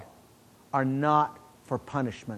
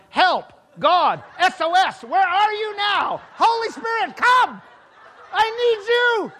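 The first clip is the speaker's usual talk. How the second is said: high, loud, desperate mock shout for help; inner yelling; 6 dB louder, slower than usual. To God, silently begging help mid-conversation, loud frantic shout. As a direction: shout to God in a panic, half laughing. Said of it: "I was yelling".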